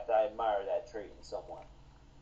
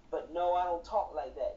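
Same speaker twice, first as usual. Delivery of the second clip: normal talking voice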